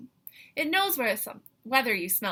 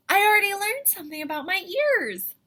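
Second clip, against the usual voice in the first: high, louder, fast thrilled rush; excited; 8 semitones higher, 5 dB louder, faster than usual. Real, not acted. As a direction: burst out with discovery.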